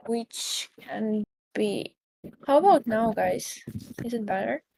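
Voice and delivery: audio dropping in and out; fading connection, hard to hear; uneven volume. Real, not acted. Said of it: "it might be".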